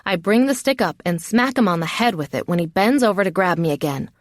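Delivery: ordinary delivery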